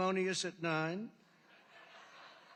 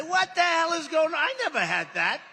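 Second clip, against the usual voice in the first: shouted high and loud, mock outrage; retold outburst; 11 semitones higher, 12 dB louder than usual. Speaker recalls yelling at a scripted debate opponent, loud mock outrage. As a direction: bark it out like a boss caught off guard.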